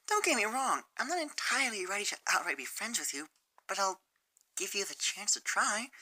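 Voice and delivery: gentle and hesitant, almost apologizing; shy Fluttershy. Acted. Fluttershy voiced.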